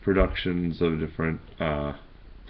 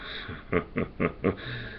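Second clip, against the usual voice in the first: Joker laugh; 10 dB quieter than usual. Acted. Joker laugh after riddle.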